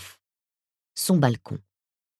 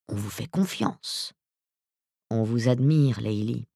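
quiet menacing man; 2 semitones lower, 4 dB quieter than usual. Acted. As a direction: threaten her calmly, almost murmuring. Narrator voices Blanc-Martin.

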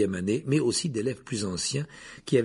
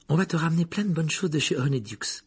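higher, lightly voiced sympathetic girl; sorry for him; 7 semitones higher than usual. A narrator's reading. Hermione promises Harry treats, feeling sorry for him. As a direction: offer comfort in a higher voice. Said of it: "qui paraissait désolée pour lui".

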